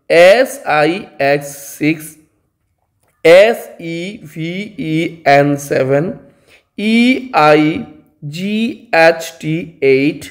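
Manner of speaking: slow letter by letter dictation; slow spelling. Shown as a demonstration. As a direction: spell each word slowly for pupils to copy. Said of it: "teacher spelling out number words". (ଧୀରେ ଧୀରେ ଅକ୍ଷର ଅକ୍ଷର କରି ବନାନ କୁହ)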